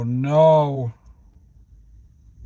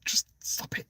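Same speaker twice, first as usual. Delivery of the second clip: softer, fading into a whispered plea; exasperated whisper; 6 semitones higher, 6 dB quieter, slower than usual. Retold or imitated. Silently pleading with the woman, whispered. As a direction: beg her to stop under your breath. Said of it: "I'm like".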